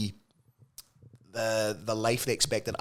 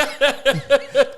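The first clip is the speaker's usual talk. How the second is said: a quick high burst of laughter; 14 semitones higher, 7 dB louder than usual. Real, not acted. Co-host joking about editing, high laugh.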